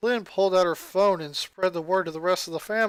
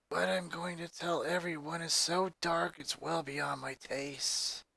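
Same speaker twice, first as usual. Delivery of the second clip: a lightly voiced somber teen; gloomy goth girl; 9 dB quieter than usual. Lucy's voice held by narrator.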